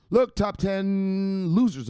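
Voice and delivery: high, loud, slow announcer call; booming segment intro; showman intro. Acted. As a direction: stretch it like a game-show host. Segment opener, drawn out.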